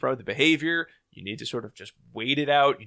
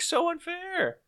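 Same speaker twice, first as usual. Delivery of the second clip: high-pitched playful whine of protest; mock indignation; 3 dB quieter than usual. Husband grumbles about kids preferring mom, mock-hurt whine. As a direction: protest like a wronged child.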